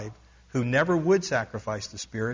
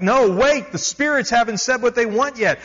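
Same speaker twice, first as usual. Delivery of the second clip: much higher, louder protest; yelling back; 9 semitones higher, 6 dB louder than usual. A narrator's reading. Villagers yelling at the missionary to wait, loud protest. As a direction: shout the missionary down in alarm. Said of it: "all the people yelled back".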